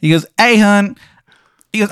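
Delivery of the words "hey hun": high, loud, hammed-up Maryland accent; a bad Maryland accent pitched way up; overdone Maryland accent, loud and high; terrible Maryland accent. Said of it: "his Maryland accent".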